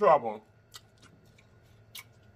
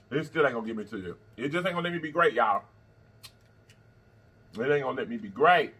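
ordinary delivery

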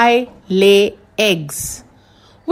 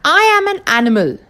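ordinary delivery

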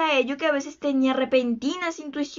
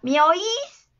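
higher, steeply rising call; raised questioning cry; mild shout; 4 semitones higher, 3 dB louder than usual. A narrator's reading. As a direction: call out into the dark for an answer. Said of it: "gritó".

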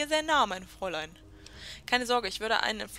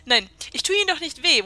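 louder, higher, mocking "Ihnen doch nicht weh"; sarcastic reassurance; 5 semitones higher, 11 dB louder than usual. Reading the sarcastic answer to the scared woman, mockingly. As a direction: sneer the comfort at her. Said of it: "das ist so sarkastisch".

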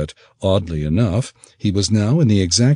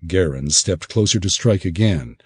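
ordinary delivery

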